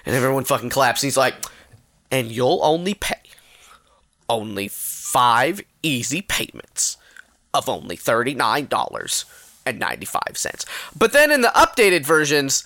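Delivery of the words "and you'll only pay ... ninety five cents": measured, understated TV-host reveal; infomercial price reveal. Retold or imitated. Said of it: "his fucking outbreak moment of".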